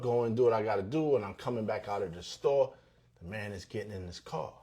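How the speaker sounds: ordinary delivery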